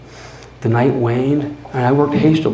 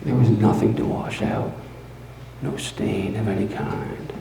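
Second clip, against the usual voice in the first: softer, confiding; hushed boast; 4 semitones lower, 7 dB quieter than usual. Acted. Narrator confiding his careful crime, hushed and proud. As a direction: lean in and murmur the secret.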